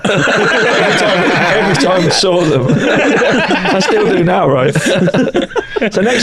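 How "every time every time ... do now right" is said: slow, laughing through every word; giggling fondly at the running joke. Real, not acted. Laughing while recalling the joke.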